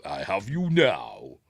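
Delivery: slow, menacing mock villain line; lightly voiced gloating Vader. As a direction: gloat it slowly as the Dark Lord. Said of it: "there we go".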